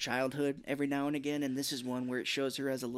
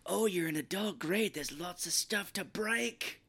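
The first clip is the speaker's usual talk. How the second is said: slow, higher gloating mischief; gleeful Fred; 5 semitones higher, slower than usual. Retold or imitated. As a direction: savor the mischief.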